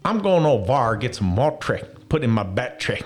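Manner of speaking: hammed-up Southern US accent, starting loud and high. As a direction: put on a broad Southern twang. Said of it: "you had to learn Southern".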